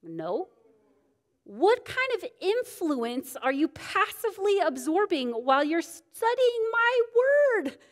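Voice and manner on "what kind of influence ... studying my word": the Lord admonishing. Retold or imitated. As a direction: rebuke her like a disappointed teacher from on high. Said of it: "He goes".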